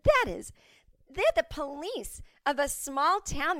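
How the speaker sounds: high, outraged protest; mock outrage at the small-town police; retold indignation. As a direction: voice jumps up in disbelief.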